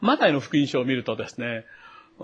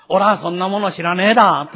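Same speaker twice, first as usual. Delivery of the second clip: louder, rustic Tohoku-dialect denial; Tohoku country dialect; 3 semitones higher, 6 dB louder than usual. Acted. Preacher voices Peter as a Galilean countryman, in Tohoku dialect. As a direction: deny it like a Tohoku country bumpkin. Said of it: "日本であれば東北弁のような言葉で使ったわけです".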